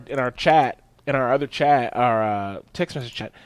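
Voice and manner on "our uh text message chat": usual speaking voice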